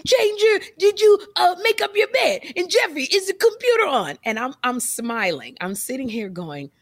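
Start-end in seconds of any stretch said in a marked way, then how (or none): 0.0-4.2 much higher nagging-mom voice, a bit louder; mimicking nagging mom. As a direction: whine the questions like a nagging mother. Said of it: "she always says".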